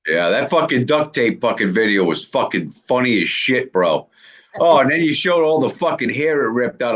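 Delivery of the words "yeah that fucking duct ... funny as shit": normal talking voice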